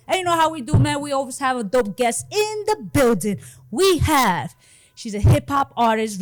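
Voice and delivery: loud, high hype host intro; rally speech. Acted. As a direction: pump up the crowd like a show opener. Hype host opening the show.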